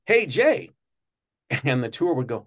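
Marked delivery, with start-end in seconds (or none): 0.1-0.7 much higher and louder hey; calling out. Retold or imitated. Tour guide calling out to Jay outside, loud. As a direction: call across the lot to him.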